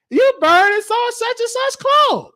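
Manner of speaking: ordinary talk, nothing marked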